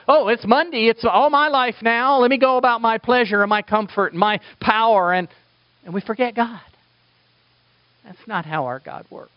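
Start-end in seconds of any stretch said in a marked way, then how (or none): 0.1-5.1 carefree self-absorption. Retold or imitated. Acting out carefree self-centred churchgoers.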